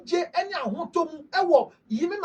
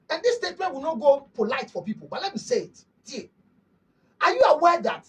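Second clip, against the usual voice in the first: ordinary delivery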